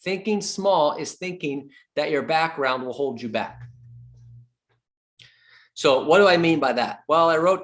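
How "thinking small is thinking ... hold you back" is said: ordinary talk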